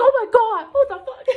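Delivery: high, loud astonished exclamation with a laugh; shocked amazement. Pretend. Seeing the universe's eyes look back, astonished. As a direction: gasp it out in disbelief. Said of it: "You're like".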